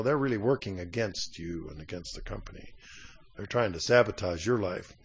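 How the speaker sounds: low, soft backbiter's aside; quietly inform on a coworker, pitched low; hushed tattling. Acted. Someone going behind your back telling the boss, hushed tattling.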